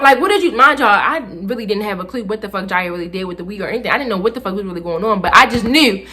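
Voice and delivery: ordinary delivery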